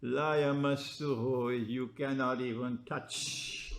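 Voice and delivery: ordinary talk, nothing marked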